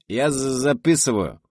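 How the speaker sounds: imitating Shurik. A narrator's reading. Izya, taking dictation, mimics Shurik slowly. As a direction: ham up Shurik stammering as he writes. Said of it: "пародируя Шурика из «Кавказской пленницы»".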